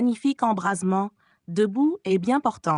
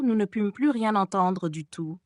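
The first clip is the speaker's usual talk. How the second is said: ordinary delivery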